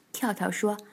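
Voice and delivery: ordinary delivery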